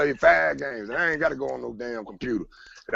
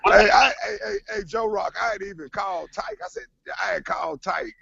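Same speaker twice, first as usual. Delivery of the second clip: much higher, half crying with laughter; breaking up laughing while telling it; excited laughing. Real, not acted. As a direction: squeal through the laughter. Laughing, worked up over tweet.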